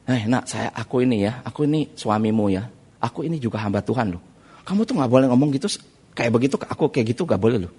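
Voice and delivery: soft, low-pitched husband's plea; quiet, restrained mild reproof; retold gentle admonishing. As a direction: act out a patient husband correcting his wife. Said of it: "Saya bilang ya".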